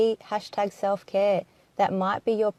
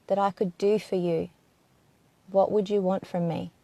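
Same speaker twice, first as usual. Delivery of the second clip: hushed, even inquiry with long silences; guided meditation. Acted. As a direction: gently put each question and leave room for an answer.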